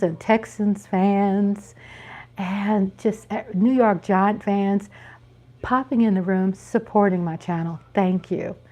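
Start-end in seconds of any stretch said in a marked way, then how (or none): none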